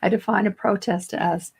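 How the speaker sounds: ordinary talk, nothing marked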